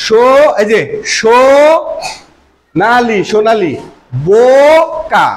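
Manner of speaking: সোনালি and বোকা stretched very slowly on the ো vowel; drawn-out demo words. Shown as a demonstration. Teacher to pupils, slowly stretching example words. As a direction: drag out each ো so the class hears it. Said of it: "teacher stretching o-sound examples".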